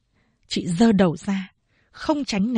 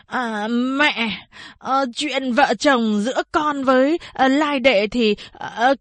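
put-on higher, halting drunk voice full of uh; drunken slur; 4 semitones higher than usual. A narrator's reading. Drunk suitor asking mother about the wedding, slurring. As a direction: stumble through it like a tipsy suitor. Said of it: "giọng lè nhè".

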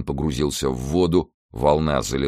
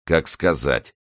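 scornful mutter; slower than usual. A narrator's reading. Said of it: "презрительно усмехнулся и пробормотал".

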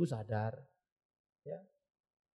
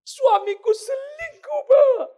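very high, loud, drawn-out cry; hammed-up distraught wail; 11 dB louder, much slower than usual. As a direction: imitate a betrayed wife wailing to her pastor. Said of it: "saya sedih, saya kecewa". (meratap histeris dibuat-buat)

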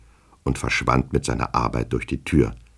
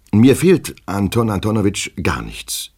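high, loud start dropping to a firm close; determined assurance, falling at the end; resolute; 6 semitones higher, 5 dB louder than usual. A narrator's reading. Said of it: "fügte er entschlossen hinzu".

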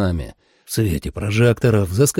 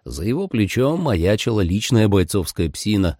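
plain reading voice

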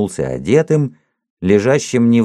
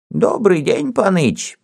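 put-on high, toothless old man mumble; mumbling old man; 11 semitones higher than usual. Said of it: "прошамкал он в свою зелёную бороду".

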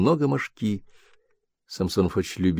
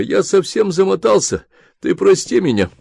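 higher, pleading apology to his wife; meek apology; 11 semitones higher, 3 dB louder than usual. Acted. Husband pleading with his angry wife, higher.

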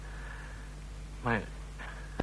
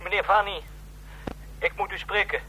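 anxious pleading; 7 semitones higher than usual. Acted. Distressed late-night call.

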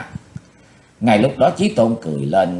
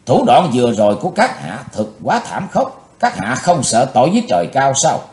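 higher, louder, mildly alarmed voice; panicked protest; 5 semitones higher, 5 dB louder than usual. A narrator's reading. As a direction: protest in mild alarm, voice raised. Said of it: "hoàng hoàng cất giọng nói".

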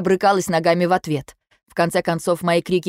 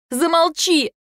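very high screamed command; shrieked shut up; 11 semitones higher, slower than usual. Acted. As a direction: cut him off with a scream. She shouts him down.

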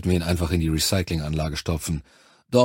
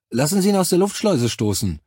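much higher-pitched, blunt proposal; insistent Charlie; 9 semitones higher, 3 dB louder than usual. A narrator's reading. Charlie proposing the airlock for the prisoner, insistent. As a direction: urge it in a high, emphatic voice.

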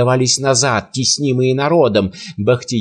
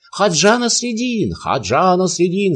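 a much higher cry of the hero's name; crowd shouting; 6 semitones higher than usual. A narrator's reading. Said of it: "закричали в толпе". (изумлённый выкрик, заметно выше)

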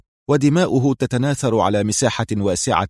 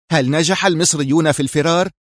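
tense; 7 semitones higher than usual. A narrator's reading. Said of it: "سأله غرينوفيتش في توتر".

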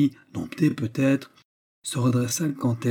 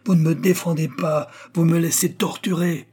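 higher, reproachful cry over a tumult; ardent outcry; 6 semitones higher, 3 dB louder than usual. A narrator's reading. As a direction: cry out in raised, indignant pitch. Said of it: "d'une voix qui domina tout le tumulte". (cri de reproche ardent)

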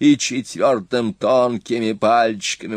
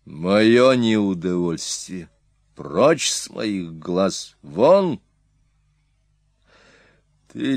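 stern king judging; slower than usual. Acted. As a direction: pronounce sentence with authority. Attila passes judgment.

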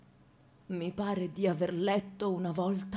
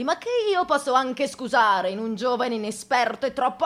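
usual speaking voice

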